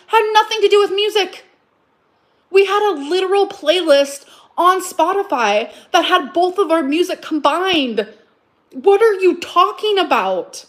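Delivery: exasperated rebuttal; indignant. Real, not acted. Rebutting ex heatedly.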